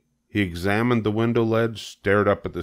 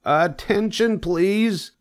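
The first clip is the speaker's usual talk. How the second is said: very high, formal call for attention; loud, firm voice; 14 semitones higher, much slower than usual. A narrator's reading. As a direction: announce it firmly in a high pig voice. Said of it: "in a loud, firm voice".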